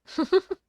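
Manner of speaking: sudden high burst of laughter; a bright squeal of a laugh. Real, not acted. Streamer laughing at own joke, high burst.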